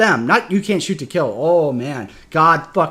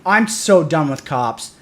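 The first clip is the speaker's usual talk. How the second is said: normal talking voice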